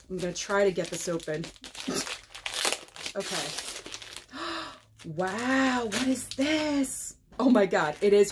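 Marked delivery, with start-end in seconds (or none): 3.5-4.7 soft startled gasp; surprised gasp. Real, not acted. Opening a gift box, a soft surprised gasp. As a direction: catch your breath at the surprise. Surprise at Christmas paper wrapping.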